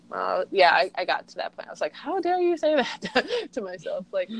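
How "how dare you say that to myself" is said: mock indignant. Scolding her own inner critic.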